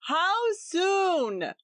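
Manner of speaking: high called-out question up the stairs; shouted. A narrator's reading. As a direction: shout it up to her. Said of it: "he shouted".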